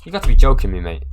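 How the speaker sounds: retold outraged disbelief; mock disbelief. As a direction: act stunned at the hand. Caught out at poker table.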